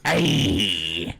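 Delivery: hammed-up pirate voice. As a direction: growl it out like a pirate. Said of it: "That's a pirate".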